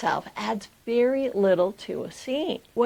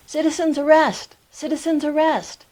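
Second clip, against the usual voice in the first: loud, high public call-out; yelling; 6 semitones higher, 5 dB louder than usual. A narrator's reading. Man on the street announcing an arrest, loud yell. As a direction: call it out twice, raised and urgent. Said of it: "I heard a man yell".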